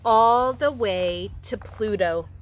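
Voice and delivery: gentle storybook reading for a class; reading to children. Acted. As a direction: read it like a picture book to young kids. Teacher reads picture book aloud.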